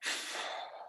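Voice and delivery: slow weary exhale; long slow sigh. Acted. Teacher lets out a long tired sigh. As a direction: let the air out slowly. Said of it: "let it out real slow".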